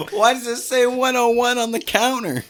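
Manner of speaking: lightly voiced puzzled coworker; confused coworker. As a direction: play the baffled teller.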